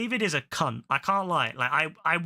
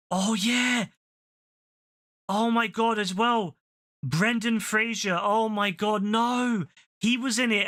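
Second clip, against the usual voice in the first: excited gush; 6 semitones higher than usual. Real, not acted. Excited about Brendan Fraser.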